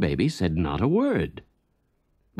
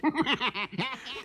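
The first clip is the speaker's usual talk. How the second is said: soft gloating chuckle; fox laughter; 6 dB quieter than usual. Acted. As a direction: chuckle to himself in triumph. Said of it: "roaring with laughter".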